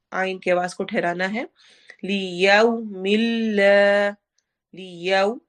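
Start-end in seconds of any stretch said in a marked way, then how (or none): 2.0-5.4 slow, rounded yau; Quran recitation. Shown as a demonstration. Teacher demonstrating rounded vowel, slow. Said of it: "वाव लीन हो रही है".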